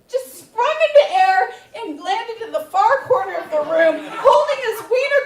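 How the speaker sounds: ordinary talk, nothing marked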